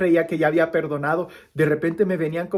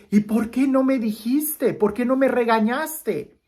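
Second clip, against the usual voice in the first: put-on higher, slow, pleading child's questions; a grandson begging to know why, higher and slow; upset boy; 8 semitones higher, 5 dB louder, slower than usual. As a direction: plead like a hurt little boy.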